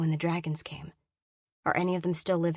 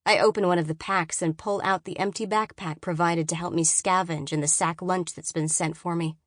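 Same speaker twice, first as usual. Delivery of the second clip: ordinary delivery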